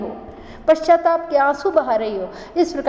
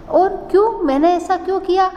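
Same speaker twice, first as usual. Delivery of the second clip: higher-pitched, mildly anguished questioning; self-questioning; 6 semitones higher than usual. Acted. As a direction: ask yourself why, voice lifted. Voices queen questioning her own deed.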